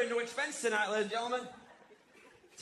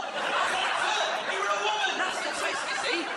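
laughing punchline; 8 semitones higher, 5 dB louder, slower than usual. Acted.

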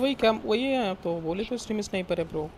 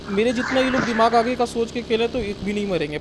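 higher, louder, heated bragging; boastful rant to teammates, louder and higher; 4 semitones higher, 6 dB louder than usual. Real, not acted. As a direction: crow about your own smarts, loud and pitched up. Bragging about his game sense.

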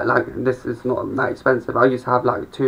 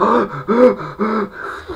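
exhausted gasp; 9 semitones higher than usual. Retold or imitated. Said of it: "I'd be like".

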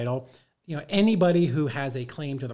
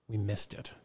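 low, soft mock admission; retold apology; 4 semitones lower, 6 dB quieter than usual. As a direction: own up quietly, imitating the culprit. Title company admits error, retold.

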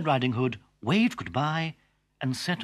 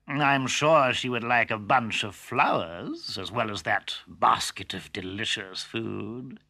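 slow sly wolf voice; wheedling villain, drawn out; cunning wolf; slower than usual. Acted. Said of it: "said the cunning wolf".

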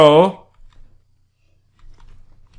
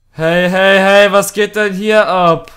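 loud startled call; 3 semitones higher, 7 dB louder, slower than usual. Real, not acted. Startled in horror game.

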